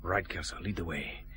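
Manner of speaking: low, very soft murmur; hushed whispering. Acted. Detective sending the customs man ahead in the warehouse, hushed. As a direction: give the order under your breath. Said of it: "all whispering for".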